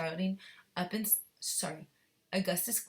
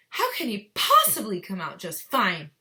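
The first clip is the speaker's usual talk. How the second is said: snapped; 9 dB louder than usual. Shown as a demonstration. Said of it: "read it a little faster".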